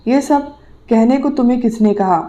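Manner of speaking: ordinary delivery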